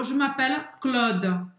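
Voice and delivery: "Je m'appelle Claude" said slowly, Claude with an open O like "Cló"; imitating Phoebe. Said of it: "Ela falou". (imitando a Phoebe, "Claude" com O aberto)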